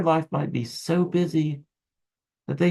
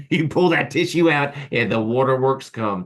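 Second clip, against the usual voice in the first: laughing; 4 dB louder than usual. Real, not acted. Laughs at her hand grenade joke.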